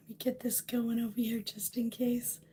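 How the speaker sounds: whispered aside to herself; breathy unvoiced whisper. Real, not acted. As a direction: mutter it under the breath while setting up. Quiet aside setting up stream.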